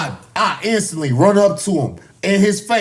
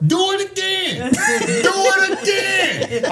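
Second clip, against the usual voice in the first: high, stretched-out call; imitated corner call; 13 semitones higher, slower than usual. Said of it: "I heard Carmel".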